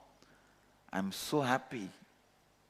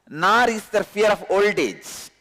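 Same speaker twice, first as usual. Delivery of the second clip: loud, raised scripture reading; solemn recitation; 5 semitones higher, 4 dB louder than usual. Acted. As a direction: proclaim the verse solemnly. Reads translation with force.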